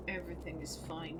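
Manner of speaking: soft, fast mumbled "Everything is fine"; nervous self-soothing murmur. Real, not acted. Scared player calming self, quiet. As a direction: reassure yourself under your breath. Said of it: "I'm fine".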